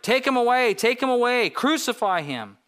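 high, loud crowd shout, easing on the last cry. A narrator's reading. Crowd answering Pilate's offer of their king, shouting. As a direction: demand it like a clamoring mob. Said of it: "But they shouted".

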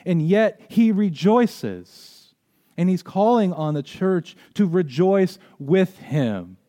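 loud, high preaching, building conviction; fervent preaching. Acted. Pastor preaching to church about joy in prison, fervent and loud.

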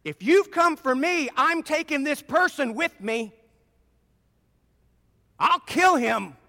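menacing hostage threat; threatening demon. Acted. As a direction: warn them you mean it. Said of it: "What the demon is telling Jesus".

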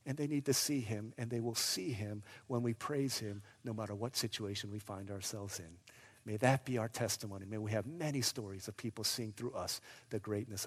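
low, soft, earnest benediction; hushed sermon close. Real, not acted. Preacher closing before prayer, hushed. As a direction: ease gently down toward prayer.

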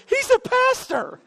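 very high, drawn-out teammate imitation; hammed-up high-pitched shock; mock astonished announcement. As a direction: squeal it slowly in comic disbelief. Teammate announcing the discovery, retold.

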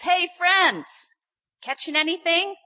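loud, high hail; calling out. Retold or imitated. Man on shore hailing fishermen, calling out. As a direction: call across the water.

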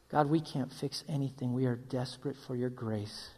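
soft, low, reverent prayer; quiet prayer. Acted. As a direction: pray gently over the congregation. Leads closing prayer.

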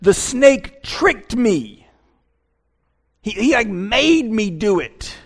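Eve making excuses. Retold or imitated. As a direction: drag out the excuse up high. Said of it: "She says".

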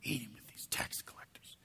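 muttered complaint dropping into a whisper; grumbling murmur. Acted. Pharisees complaining about eating with tax collectors, muttering. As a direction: grumble it low, then whisper the disapproval. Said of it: "These guys show up and they're goguzu".

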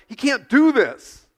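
freaked-out protest. Retold or imitated. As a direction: let them protest in shock. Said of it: "They're like".

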